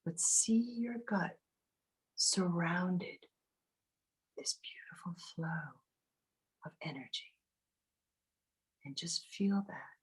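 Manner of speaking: breathy meditation whisper. Acted. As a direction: whisper it close and soft.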